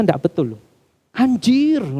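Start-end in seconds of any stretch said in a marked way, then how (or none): 1.1-1.9 higher-pitched mock gamer exclamation; imitating hyped gamer. Preacher mimicking gamers cursing mid-game, high mock outburst. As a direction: blurt it like an adrenaline-charged gamer, imitated. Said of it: "saking adrenalinnya gini, itu sampai ngomongnya".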